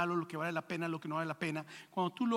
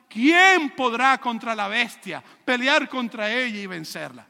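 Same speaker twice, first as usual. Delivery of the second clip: booming question; 10 dB louder, slower than usual. Acted. Preacher voicing crowd's awed question.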